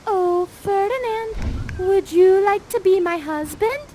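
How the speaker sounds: put-on dreamy teen proposal; lovestruck proposal. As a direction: swoon as you ask him. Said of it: "Miranda's like".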